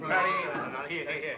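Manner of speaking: loud, high cry to restrain someone; alarmed shout. Acted. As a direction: call out urgently to stop her. Others intervene in the outburst.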